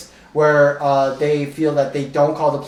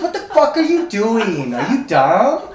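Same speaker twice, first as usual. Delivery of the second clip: higher, laughing mock outburst; laughing retold outburst; 5 semitones higher than usual. The slapped man yelling at the woman, a laughing mock shout. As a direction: give his outburst through a laugh.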